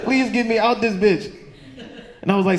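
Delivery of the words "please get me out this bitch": high pleading wail; hammed-up panicked begging; mock desperate plea. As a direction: beg to be let out. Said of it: "started sounding like the Mexican dude".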